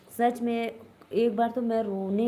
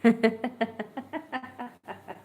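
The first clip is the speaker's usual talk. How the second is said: burst of laughter; 3 semitones higher than usual. Real, not acted. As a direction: laugh out at the comment. Laughs at a chat comment. (ज़ोर की हँसी)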